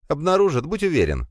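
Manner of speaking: put-on spider girl voice. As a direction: grumble it as the young spider girl.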